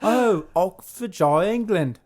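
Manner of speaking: a playful fake English accent, drawn out; mock posh English. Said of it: "Fancy a tea and crumpets".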